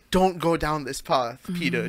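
soft warning. Retold or imitated. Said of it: "just that look, and you knew".

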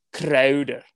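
mildly imitated Scottish accent. Retelling the Scottish coworker's one-word trade news, mock Scottish accent. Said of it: "he's Scottish".

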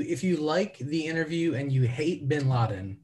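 ordinary talk, nothing marked

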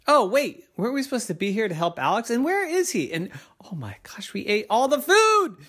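high, rushed, dismayed remembering; sudden realization. Acted. Kids remembering they came to help Alex, alarmed. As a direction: blurt it out as it dawns on you.

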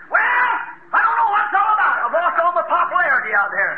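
high complaining voice; whining doubter. Acted. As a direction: fret like a losing preacher. Impatient believer voiced.

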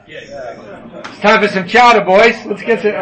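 slow, hammed-up New England accent. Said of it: "in the New England accent".

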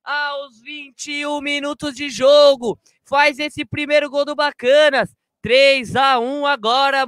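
roaring, stretched-out goal call; explosive goal commentary. Real, not acted. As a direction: scream the goal, hold every vowel. Goal scored, commentator erupts.

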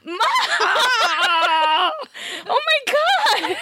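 high startled shriek breaking into laughter; scream into giggles. Real, not acted. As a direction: scream at the jump scare, then giggle. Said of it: "That's not how they sound".

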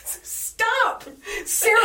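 sudden high squeal of protest; laughing high outcry at a friend's joke; playful protest. Real, not acted. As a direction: shriek it out in mock alarm. Teasing friend about British viewers.

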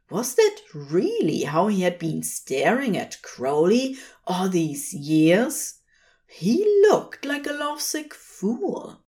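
inwardly aghast at his own doting stare; mortified inner thought. A narrator's reading. Angel Aziraphale realizes how he looked at Crowley, mortified.

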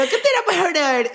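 very high comic bro voice; mock a pushy friend in a squeaky pitch; caricatured high-pitched heckle; exaggerated impression of Lu. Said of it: "mi percepción de cómo yo te percibo".

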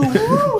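falsetto whoosh. Shown as a demonstration. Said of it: "o som de você indo rápido é o som do".

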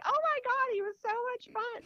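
put-on very high, loud, excited kid; gushing child. Son raving to mother about playmate, excited. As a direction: gush like a delighted little boy.

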